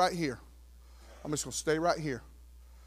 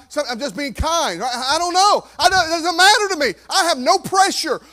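loud, high, driving sermon delivery; rising to a loud pulpit peak; full-force preaching; 10 semitones higher, 13 dB louder than usual. Acted. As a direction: preach it out to the back row.

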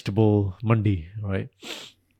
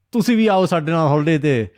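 put-on Punjabi accent; 6 semitones higher, 4 dB louder, much faster than usual. Uncles' speech at holiday gathering.

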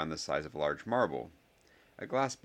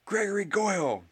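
high, loud challenge yell; scream; 6 dB louder than usual. A narrator's reading. Harry calls out Goyle, screamed challenge. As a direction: scream the name across the field. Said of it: "screamed Harry".

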